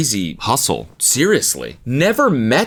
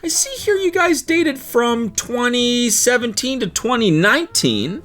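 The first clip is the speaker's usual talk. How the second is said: high-pitched, fake-polite phone interviewer; hesitant caller asking awkward questions; reference-check caller; 8 semitones higher than usual. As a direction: play a chirpy background checker, voice pitched up. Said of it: "she's gonna call up the exes".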